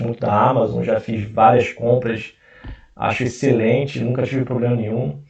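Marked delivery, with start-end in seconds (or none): none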